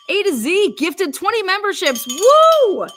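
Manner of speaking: high excited announcement rising into a whoop; excited cheer. Real, not acted. Streamer hailing a viewer who gifted memberships, real excitement. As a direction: celebrate it, ending on a loud high woo.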